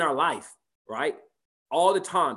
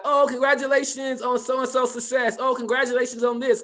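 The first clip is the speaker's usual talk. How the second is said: higher, sugary polite cheer; mock congratulations; 7 semitones higher than usual. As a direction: gush politely without meaning it.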